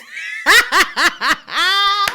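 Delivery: very loud, high shrieking laugh; explosive laughter. Real, not acted. Friends cracking up over "be my legacy", shrieking laugh. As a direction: burst into real, screeching laughter.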